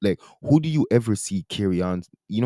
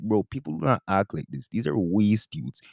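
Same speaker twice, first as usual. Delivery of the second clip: usual speaking voice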